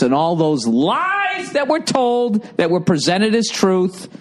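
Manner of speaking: high, drawn-out outraged yell; indignant rant. Real, not acted. Indignant rant at Patriots haters.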